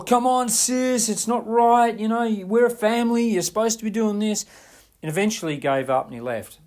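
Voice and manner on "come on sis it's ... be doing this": higher, louder whining demand through a door; angry pleading brother. Retold or imitated. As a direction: plead and accuse at once, like a spoiled brother. Brother banging on bathroom door.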